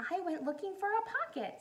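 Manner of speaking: a hint of a small high bear; lightly voiced bear. Corduroy explains to Lisa, a hint of a small bear. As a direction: say it sheepishly. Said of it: "said Corduroy".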